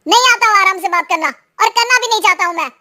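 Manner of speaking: quick, angry comeback; angry retort. Acted. Husband refuses to talk calmly with wife, angry. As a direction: fire back crossly, rushing the first words. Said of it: "दुख गुस्से में बदल गया था".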